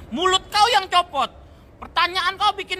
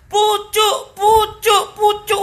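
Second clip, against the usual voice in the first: shouted jingle; 3 semitones higher, 5 dB louder, much slower than usual. Acted. Calling brand name like an ad.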